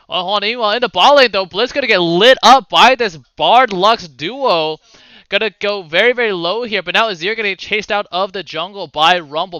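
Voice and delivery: esports caster calling the action; run-on, energetic game commentary; live play-by-play. Acted. Caster to viewers as the blue buff is stolen, live play-by-play.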